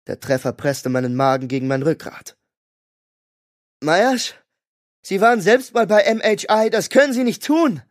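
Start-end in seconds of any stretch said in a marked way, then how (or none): 3.8-7.8 much higher, louder begging; pleading. A narrator's reading. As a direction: plead with him to stop. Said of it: "sagte Julie flehentlich".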